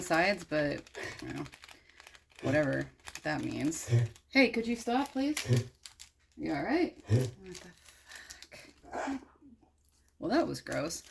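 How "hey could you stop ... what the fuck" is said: calling to the dog. Real, not acted. Speaker to his choking dog, higher concerned call.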